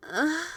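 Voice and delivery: sudden rising "ah" of relief; pleased gasp. Acted. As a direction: gasp as the knot is found. Massage hits a sore spot.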